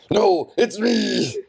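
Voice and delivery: high, drawn-out mock outburst; hammed-up slow, high exclamation; blurting protest. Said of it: "and he goes".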